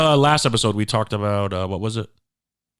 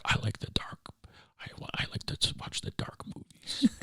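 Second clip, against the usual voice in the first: put-on breathy hushed whisper of a brooding boy; mock whisper; 8 semitones higher, 14 dB quieter than usual. Younger self whispering about liking dark movies. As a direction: whisper it like a moody kid confessing. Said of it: "I was always like".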